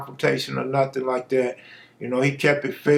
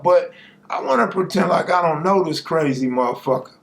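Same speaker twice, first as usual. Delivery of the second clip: normal talking voice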